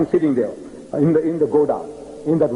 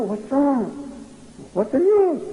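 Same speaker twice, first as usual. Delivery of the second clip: higher, alarmed questioning; mock concern; 10 semitones higher, slower than usual. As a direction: ask anxiously what happened. Missionary asks the crying sheikh.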